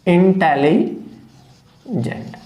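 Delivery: drawn out very slowly, syllable by syllable; slow demo word. Shown as a demonstration. Teacher pronouncing a new word slowly for the students. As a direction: stretch the word out for the class. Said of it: "मैं और लिख लेता हूं यहां intelligent".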